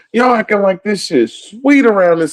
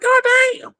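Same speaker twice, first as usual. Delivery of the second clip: sudden very high squeal of disbelief; shocked squeal. Real, not acted. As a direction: shriek it like you can't believe the price. Outraged at food prices.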